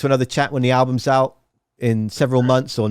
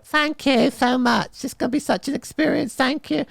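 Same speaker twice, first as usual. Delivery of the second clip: put-on gushing child, pitched way up; seven-year-old child; 13 semitones higher than usual. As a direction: thank them like an overawed seven-year-old. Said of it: "I should be seven years old".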